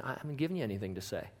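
hushed Lord. Pretend. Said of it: "the Lord is saying".

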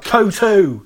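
high, drawn-out call from the back; mock heckler's shout. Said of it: "Someone from the back even shouts out".

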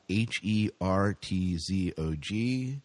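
ordinary delivery

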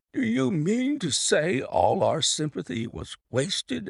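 high, drawn-out amused protest; amused disbelief. A narrator's reading. Colonel to Holmes about the faked fit, amused disbelief. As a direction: ask it high and slow, mildly incredulous. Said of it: "cried the colonel, laughing".

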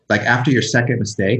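ordinary delivery

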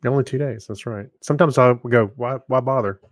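ordinary talk, nothing marked

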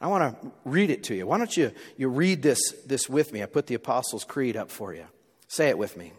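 ordinary talk

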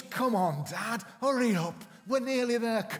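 put-on loud, high impatient kid; be the kid now racing ahead; eager child. Children urging their dad to the top, loud and eager.

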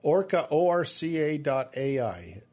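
Orca, then O R C A letter by letter; spelling it out. Shown as a demonstration. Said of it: "so spell that for me".

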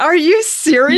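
high-pitched incredulous question; real, high, rising disbelief; real amazement. Real, not acted. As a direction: react astonished, pitch leaping up. Learns guest owns signed cookbook.

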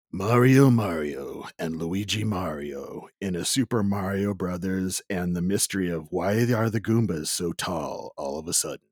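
deep movie-trailer announcer parody; movie guy voice. Acted. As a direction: intone it like a dramatic trailer narrator. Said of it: "the movie guy voice".

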